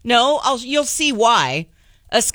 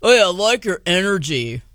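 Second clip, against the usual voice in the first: imitating an American accent. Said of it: "I love when he goes into his American accent".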